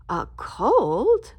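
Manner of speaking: ordinary delivery, nothing marked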